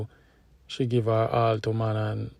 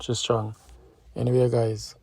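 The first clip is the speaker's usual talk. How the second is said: normal talking voice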